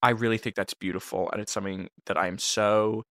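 hushed, earnest gratitude; mock heartfelt. Producer thanks host for including him in her family, soft and sincere. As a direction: speak softly and low, humbly moved.

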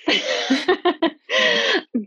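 high-pitched laughing burst; real high, giggly laugh. Real, not acted. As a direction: laugh at your own messy answer. Guest laughs at her own answer.

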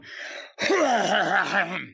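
hacking cough to clear the throat. Real, not acted. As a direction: clear your throat before performing. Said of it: "I cleared it".

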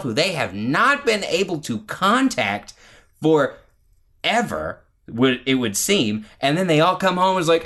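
ordinary talk

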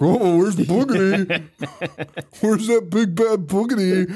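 hammed-up fake-spooky teasing; mocking. Family mocking the daughter outside, high taunting call. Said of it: "it's just to mock the daughter".

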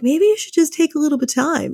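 high, quick, lightly voiced gentle suggestion; God. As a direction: pitch it up as a divine nudge. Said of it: "God's like".

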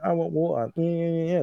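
put-on whining toddler. Father imitating his son at bedtime, whining. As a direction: mimic a small child whining for something. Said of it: "I can hear him whining".

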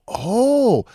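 fooling a police officer, mock clueless and high; playing dumb. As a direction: act clueless and dim. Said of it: "I'm gonna play dumb".

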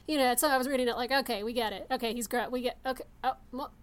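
ordinary talk

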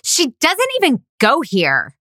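lightly voiced high-pitched mean girl jab; movie quote in a put-on voice; quoting a movie line. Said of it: "my heartfelt performance".

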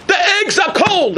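high laughing mock yell; hammed-up cry of complaint, laughing; a diner griping, laughing. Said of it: "I hear a guy scream".

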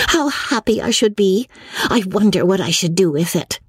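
put-on high, delighted girlish voice; excited cry. A narrator's reading. Margaret dreaming of what she would buy, excited. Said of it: "cried Margaret".

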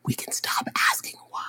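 imitating a worn-out dad, hushed; mock-patient plea, very soft; strained patient whisper. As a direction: drop to a whisper, pleading for a break. Exasperated dad pleading for a break.

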